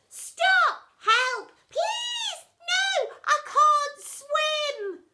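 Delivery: panicked screams. A narrator's reading. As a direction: scream like kids drowning. Kids scream for help.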